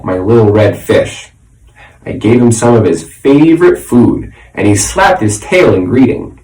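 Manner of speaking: ordinary delivery, nothing marked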